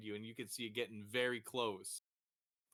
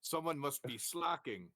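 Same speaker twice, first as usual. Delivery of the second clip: Grum; 6 semitones higher, 4 dB louder than usual. Acted. Game figure speaks in broken speech.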